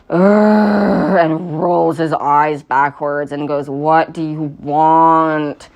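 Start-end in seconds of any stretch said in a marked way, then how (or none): 0.1-1.2 bored groan. Retold or imitated. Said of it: "he's just like". 3.7-5.7 slow, drawn-out bored question. Retold or imitated. Bored shopkeeper Yustov greets Gray, slow and fed up. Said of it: "He looks incredibly bored".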